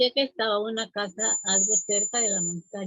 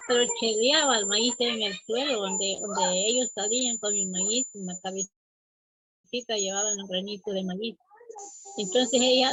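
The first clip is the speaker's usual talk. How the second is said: usual speaking voice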